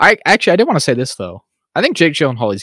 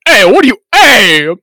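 mock outraged shout; 9 semitones higher, 8 dB louder than usual.

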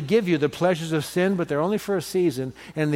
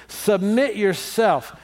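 slow emphatic; 3 semitones higher, much slower than usual. Shown as a demonstration. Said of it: "Tell somebody, turn to somebody and say".

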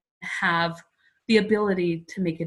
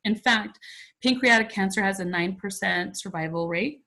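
ordinary delivery

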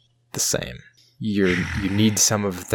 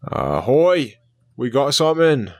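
shouting from crow's nest; 5 semitones higher than usual. Acted. Lookout calling from crow's nest.

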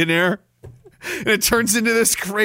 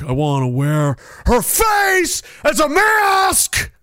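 Nicolas Cage shout; 8 semitones higher, much slower than usual. Acted. Streamer doing Cage as Patrick Bateman, slow unhinged yell. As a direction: howl it out like a manic Cage. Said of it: "talk like Nicolas Cage".

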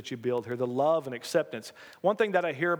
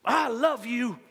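higher, heartfelt big-hugger voice; imitating Charlie; 7 semitones higher, slower than usual. Church member greets the pastor after a crushing hug, exuberant imitation. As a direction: impersonate an exuberant friend declaring affection. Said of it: "pulls me out at arm's length and says".

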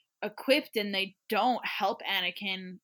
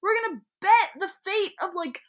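incredulous; 9 semitones higher than usual. Real, not acted. Incredulous rant about Anakin.

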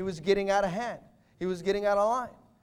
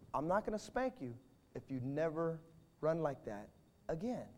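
gentle father; 5 semitones lower, 8 dB quieter than usual. Retold or imitated. Father gently calms crying son.

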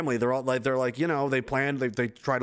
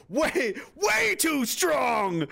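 high, slow, shouted build on way; drawn-out hype; 10 semitones higher, much slower than usual. Acted. Hyping next week's strong guest, slow high shout. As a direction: stretch each way higher.

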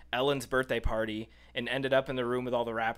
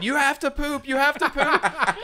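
high, loud insistent shout; stoned screaming; 9 dB louder than usual. Retold or imitated. Too high at the party, shouting at a woman in line. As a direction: yell it kindly at a stranger. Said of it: "she didn't realize she was screaming".

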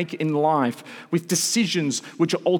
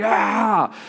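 drawn-out, higher-pitched mock yell; imitated yell; 5 semitones higher, 4 dB louder than usual. Dad imitating his twin son bursting in, mock yell. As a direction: let out a kid's wild yell, mimicked. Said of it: "a yell, like".

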